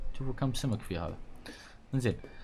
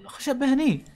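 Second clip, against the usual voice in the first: high, loud mocking complaint; mock their slowness; sarcastic rant; 9 semitones higher, 5 dB louder than usual. Real, not acted.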